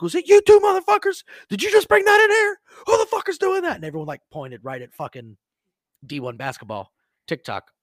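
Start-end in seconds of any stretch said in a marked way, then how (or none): 0.0-3.8 full-volume, high-pitched angry yelling; re-enacted furious interrogation at a shout; screaming. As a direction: yell accusations at the culprits. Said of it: "and I'm screaming".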